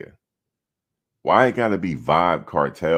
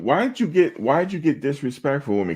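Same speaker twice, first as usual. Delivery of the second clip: pitched up, indignant question; rising outrage at a viewer; 10 semitones higher than usual. Real, not acted. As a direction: demand an answer, offended. Heated complaint to chat.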